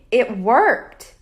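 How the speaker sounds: ordinary delivery, nothing marked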